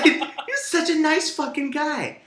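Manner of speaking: laughing through the words. Real, not acted. To his co-host about the nice guy, laughing.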